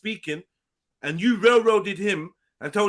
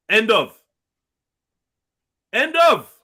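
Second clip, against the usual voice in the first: emphatic final word; 4 semitones higher, 7 dB louder, slower than usual. Real, not acted. Host closing the argument emphatically.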